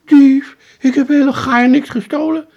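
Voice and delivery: high, loud, outraged working man protesting innocence; indignant painter. Acted. Trapped painter protests to the boy, high and indignant.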